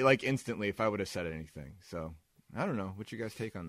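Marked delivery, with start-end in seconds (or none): none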